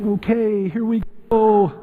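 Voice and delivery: high, drawn-out mock bracing; reluctant resignation. Family facing another song from the teenager, resigned. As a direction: brace yourself to sit through it. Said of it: "people are thinking".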